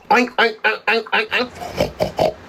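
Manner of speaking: oink like a hog to taunt her; mocking pig oinks. Taunting a woman called a hog, mocking oinks.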